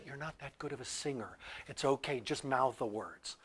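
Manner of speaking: soft, hushed put-down, slowing at the end; dismissive teacher. Retold or imitated. A teacher telling a child to mouth the words, softly dismissive. Teacher's discouraging remark, retold.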